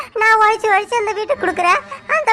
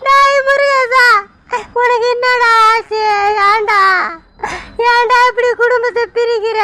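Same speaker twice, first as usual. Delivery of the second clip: tearful pleading; 2 semitones higher than usual. Acted. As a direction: beg him through tears not to split the family. Mother pleads with son, crying.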